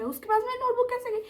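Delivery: high, quick baffled question; sudden baffled outburst; puzzled disbelief. Real, not acted. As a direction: ask it in disbelief, racing. Puzzled how notebook moved.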